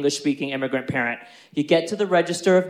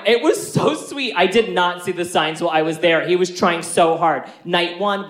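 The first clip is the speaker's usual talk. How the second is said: usual speaking voice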